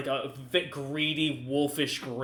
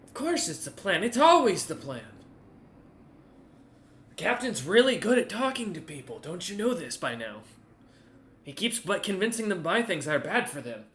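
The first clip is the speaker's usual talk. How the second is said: higher, swooping lines of a put-upon underling; condescending; 4 semitones higher than usual. Acted. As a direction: talk down as if explaining the obvious. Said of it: "as if talking y-, to you demeaned him".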